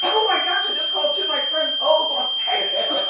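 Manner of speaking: high, loud panicked cry; loud mortified squeal. Retold or imitated. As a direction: shriek it in horror at your own mistake. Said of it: "And I'm like".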